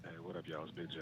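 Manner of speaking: soft, hushed phone voice; whisper voice. Real, not acted. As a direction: lean in and speak barely above a whisper.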